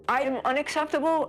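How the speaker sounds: high-pitched, incredulous retort; retold disbelief, voice jumping up; indignant echo. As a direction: throw the accusation back as an offended question.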